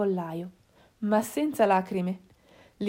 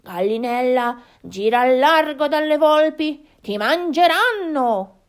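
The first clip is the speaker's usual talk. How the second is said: warning wild boars; 5 semitones higher, 6 dB louder than usual. Acted. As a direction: warn of danger, building higher and louder. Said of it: "aggiunsero a bassa voce".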